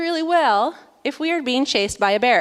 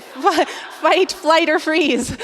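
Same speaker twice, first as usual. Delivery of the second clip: laughing at herself; 5 semitones higher, much slower than usual. Real, not acted.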